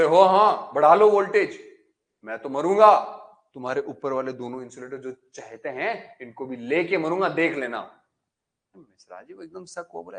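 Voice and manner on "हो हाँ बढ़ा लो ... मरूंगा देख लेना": loud, defiant shout; shouting back. Acted. Insulator shouts defiance at Mishra ji, loud. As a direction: yell the threat back at him. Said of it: "इसने भी चिल्ला के बोल दिया".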